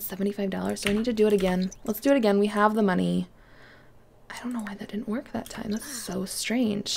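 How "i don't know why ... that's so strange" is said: puzzled whisper to herself; quiet confused whisper. Real, not acted. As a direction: murmur it under your breath, baffled.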